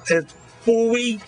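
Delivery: high, teasing wabbit talk; mocking the girl's lisp. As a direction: play along with the kid's lisp, sing-song. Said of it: "He goes".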